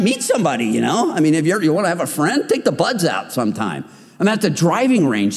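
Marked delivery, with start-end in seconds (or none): none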